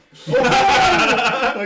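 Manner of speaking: high, loud laugh bursting out; loud burst of laughter. Real, not acted. GM laughing loudly at the player's natural one. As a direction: crack up at the failed roll.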